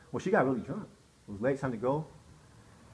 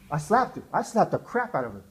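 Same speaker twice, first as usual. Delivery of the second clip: usual speaking voice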